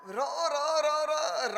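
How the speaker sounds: halting, repeated रो रो like a paralysed tongue; lightly imitated stammer, high and strained; imitating a stutter. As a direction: mimic the old mother stuck on रो. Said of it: "तुतलाती थी".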